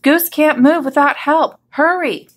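high-pitched call for haste; urgent shout. A narrator's reading. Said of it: "shouted Hippo".

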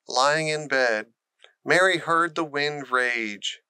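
plain reading voice

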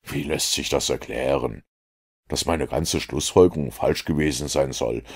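ordinary delivery, nothing marked

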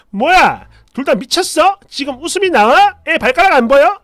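loud, high indignant questions; angry outburst. Retold or imitated. Wife catches husband and mother laughing, angry high outburst. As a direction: flare up and fire off accusing questions. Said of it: "버럭 하면서 소리를 질러요".

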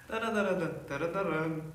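mock the old Seoul way of talking on TV; a playful take on retro Seoul speech; old Seoul news interview. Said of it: "이런 거".

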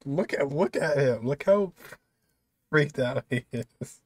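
ordinary delivery

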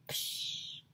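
breathy burst for a popping head; whispered exploding puff. A narrator's reading. Boy imitating his head exploding, soft puff.

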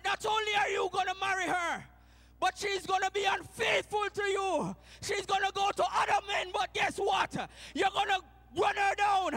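loud, high preaching shout as God; booming voice of God. Acted. God commanding the prophet, loud and forceful. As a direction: thunder God's command.

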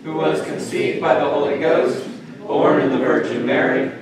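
steady unison recitation, measured pace; recited creed. Acted. Congregation reciting the creed together. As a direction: recite the creed as one body.

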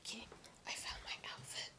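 barely audible whisper to keep quiet. Real, not acted. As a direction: whisper so brother stays asleep. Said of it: "I have to be super quiet".

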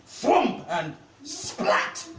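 slow, high, punchy sound-effect words; spell impact noises. A narrator's reading. Storyteller lands the stunning orb, slow dramatic impact noises. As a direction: draw out each impact with relish.

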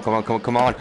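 ordinary talk, nothing marked

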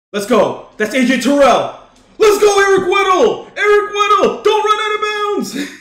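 loud, high cheering; real hype, yelling at the screen; excited shouting. Real, not acted. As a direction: shout the runner toward the end zone. Cheering the runner on.

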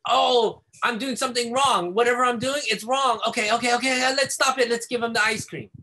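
a parent flustered into giving in fast; freaked-out parent. Retold or imitated. Parent panicking after child spits, mock frantic voice. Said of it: "to be freaked out".

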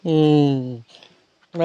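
drawn-out whimpering puppy sound. Acted. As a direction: whine softly like a small puppy. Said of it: "जानवरों की भी आप आवाज़".

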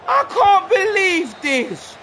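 slow, high, drawn-out wail; anguished disbelief. Real, not acted. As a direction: stretch each word out in exasperated despair. Despairing after conceding in game.

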